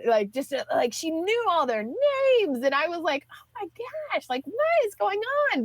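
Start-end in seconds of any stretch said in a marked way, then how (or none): 3.3-5.7 amazed. Retold or imitated. Amazed at sister's popularity.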